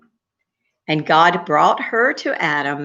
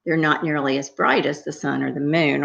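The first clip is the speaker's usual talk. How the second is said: ordinary delivery, nothing marked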